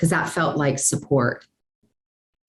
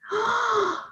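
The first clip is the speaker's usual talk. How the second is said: shocked gasp; 5 dB quieter than usual. Retold or imitated. Shocked by mentor's note.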